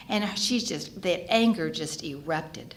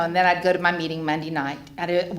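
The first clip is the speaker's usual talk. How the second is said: usual speaking voice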